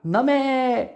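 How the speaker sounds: a high shouted name, half-voiced; loud call. Aunt yelling after her niece as she runs off, high call. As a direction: yell the name after the fleeing girl. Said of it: "ही जोरात ओरडणार".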